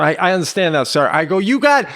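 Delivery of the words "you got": high, loud, cut-off outburst; yelling. Retold or imitated. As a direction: start yelling at someone approaching. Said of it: "I yell to him".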